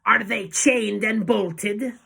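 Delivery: slow, high, harsh demand; Grand High Witch. A narrator's reading. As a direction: shout it slowly as a commanding witch. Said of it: "shouted the Grand High Witch in a voice".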